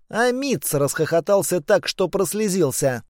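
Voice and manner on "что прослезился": usual speaking voice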